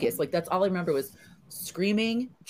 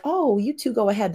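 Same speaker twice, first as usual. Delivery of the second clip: imitating the guide; 8 semitones higher than usual. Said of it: "he's like".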